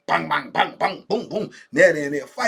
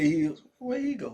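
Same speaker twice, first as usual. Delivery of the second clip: normal talking voice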